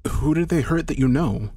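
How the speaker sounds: usual speaking voice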